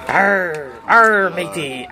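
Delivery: low, slow playful pirate. Pretend. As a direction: growl it like a pirate. Pirate voice answering.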